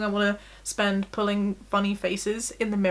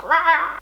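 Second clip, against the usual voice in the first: short, loud, higher wail; hammed-up bawling noise; mock ugly-crying wail; 6 semitones higher, 5 dB louder than usual. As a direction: imitate a burst of messy crying. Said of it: "ugly crying and candles were coming out".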